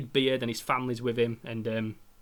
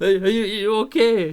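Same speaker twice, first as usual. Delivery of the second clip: higher, louder, slow halting voice; choked-up question; 7 semitones higher, 6 dB louder than usual. Retold or imitated. Phoning his girlfriend upset, halting and loud. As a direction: sound upset and shaky. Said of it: "I phoned her last and I'm like that".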